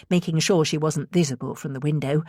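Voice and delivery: plain reading voice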